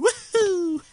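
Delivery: high, loud "woohoo"; gleeful falsetto whoop. Real, not acted. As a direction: whoop as you leap. Jumping again.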